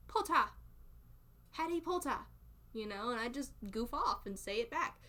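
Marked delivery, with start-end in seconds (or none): 0.1-2.2 high, hammed-up British "Potter"; British accent. Shown as a demonstration. Streamer goofing off with Harry Potter words, high British accent. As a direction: pitch it up, British, dropping the R in "Potter". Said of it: "I say Potter".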